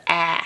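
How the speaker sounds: "a" said as in cat; short A sound. Shown as a demonstration. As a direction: say a as the first vowel of ant. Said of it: "its normal short A sound".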